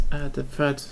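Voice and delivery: normal talking voice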